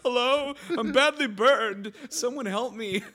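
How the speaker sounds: high, loud, slow wailing plea for help; Will Ferrell burned henchman. Retold or imitated. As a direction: wail it like a badly burned henchman left alone. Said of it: "all you hear is Will Ferrell's like".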